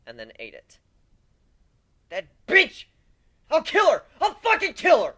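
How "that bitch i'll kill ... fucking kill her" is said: loud, high raging yell; yelling vow of revenge; furious shout. Acted. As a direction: scream out murderous rage. Rage at Ember.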